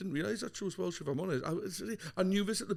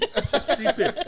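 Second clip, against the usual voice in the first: amused echo; 10 semitones higher, 8 dB louder, slower than usual. Pretend. Mock repeating the Welsh word.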